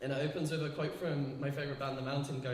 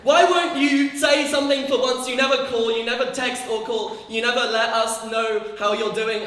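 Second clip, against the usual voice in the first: loud, raised scolding parent; parent's reproach; 6 semitones higher, 10 dB louder than usual. Pretend. Parent scolding absent child on the phone, loud reproach.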